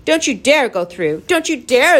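loud, high shouted threat; bawling. A narrator's reading. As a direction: bawl it at them like furious creatures. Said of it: "they bawled".

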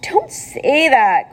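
groaning. Acted. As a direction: groan it out slowly. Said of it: "groaned Wilbur".

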